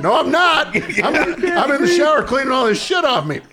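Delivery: high, loud, laughing denial; laughing yell back at the bat boy; loud laughing protest. Retold or imitated. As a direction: shout it back from the shower, cracking up. Protesting from the shower.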